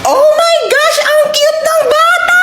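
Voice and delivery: very high, loud, drawn-out squeal; piercingly high gushing shriek. Acted. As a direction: scream with delight over a baby. Squealing over the cute baby.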